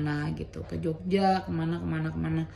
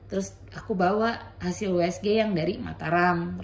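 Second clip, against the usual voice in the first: ordinary delivery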